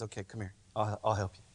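calm him down gently; gentle reassurance. Acted. Jesus quietly reassuring frightened Peter. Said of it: "what does Jesus do?".

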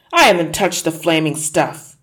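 loud, indignant denial; angry shout. Acted. Husband shouting back at his nagging wife. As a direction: bark the denial at her. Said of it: "Mister Wormwood shouted".

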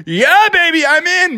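Froggy hyped, shouting to his receiver; Froggy cheering. Acted. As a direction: celebrate the touchdown. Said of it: "he was like".

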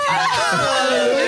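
high, slow church shout; gospel hallelujah shout. Pretend. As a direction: stretch it out like a revival crowd. Congregation cheers right fact.

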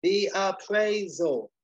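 THE APPRAISAL drawn out slowly, high; slow, emphatic repeat. Acted. Dealer repeating to a confused waiter, "the appraisal" slow and high. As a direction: stretch out "the appraisal" for someone not understanding.

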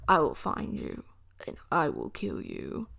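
low, hushed menacing movie line; Liam Neeson. Acted. Quoting his famous Taken threat to listeners, low and menacing. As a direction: deliver the threat low, voice dropping to near-whisper. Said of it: "That's the iconic Liam Neeson".

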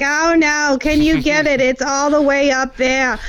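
put-on high, whiny Brooklyn accent; helpless damsel in a Brooklyn accent; Janine Brooklyn accent. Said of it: "talking in a Brooklyn accent".

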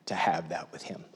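low soft whisper. Real, not acted. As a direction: drop to a whisper. Intimate sermon aside.

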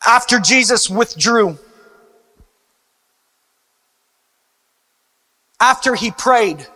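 reverent. Acted. Quiet reverent prayer section.

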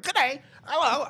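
high, loud cheery mock greeting; mock Aussie greeting. Stranded friend greeting the stranger, mock cheery high voice. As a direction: chirp a fake-friendly good day. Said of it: "I was like".

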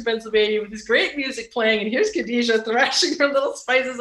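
usual speaking voice

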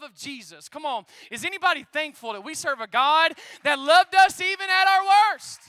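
loud, rising sermon build to a cry; preaching at full force. Acted. Pastor rousing the church, full-force preaching. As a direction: drive the congregation up to a shout.

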